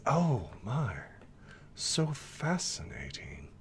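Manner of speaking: breath-soft creature marvelling; hushed fascination. Acted. Fox follows the zebra, hushed fascinated murmur. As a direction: start in a whisper, stay very soft.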